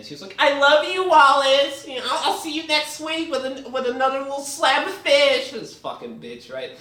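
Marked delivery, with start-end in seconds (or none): 0.4-5.5 very high put-on girlfriend voice; mock a doting girlfriend in falsetto. Reviewer mocking what his girlfriend was saying, high girlfriend voice. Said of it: "what was his bitch-made girlfriend saying?".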